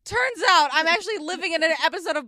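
loud, high, sudden revelation; excited exclaim. Retold or imitated. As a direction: blurt it out thrilled. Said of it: "Missus B is like".

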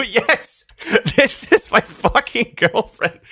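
high, drawn-out words squeezed through laughter; laughing retelling. Retold or imitated. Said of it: "I just start dying laughing and I go".

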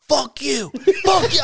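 put-on high, loud squeaky kid voice yelling; mock angry kid. Angry sixth grader cursing at his teacher, high squeaky yell. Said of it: "this kid's like".

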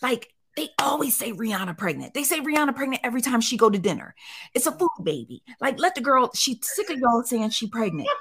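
sassy lecture to her daughter; mock scolding. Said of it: "so I'm clapping".

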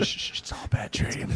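unvoiced whisper, teasing; mock whisper. Teasing a scared teammate under the stands, mock whisper. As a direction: breathe it out as a fake comfort.

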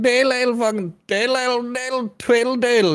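high, fast nonsense mimicking a thick accent; mock rural Irish accent. Mocking the locals up north in a rural Irish accent. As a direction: lilt it up like a fiddle tune. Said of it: "everyone's like".

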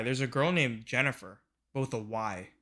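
ordinary talk

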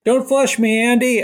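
high, squeaky little fish; pleading fish voice. Pretend. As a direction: beg in a tiny high voice. Voicing the fish.

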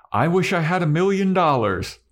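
high, eager wish; Willow. Retold or imitated. Testing whether wishes come true, high eager voice. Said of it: "then Willow goes".

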